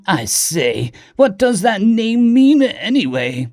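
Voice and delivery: high, drawn-out upper-class British question; posh British accent. A narrator's reading. Diplomat asks Billy Bob about the name, posh British accent. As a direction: stretch it out like a posh British gentleman. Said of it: "The posh upper-class British accent".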